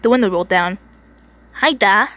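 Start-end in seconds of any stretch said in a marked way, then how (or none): 1.6-2.1 put-on Texan greeting in a mistaken accent; louder, higher greeting, accent slightly off; wrong accent. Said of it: "Mate, wrong accent".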